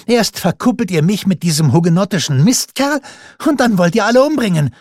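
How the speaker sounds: high, fast furious accusation; outraged outcry. A narrator's reading. Margarete to her mother, a high furious outcry. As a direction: let the outrage spill over. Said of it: "schrie Margarete".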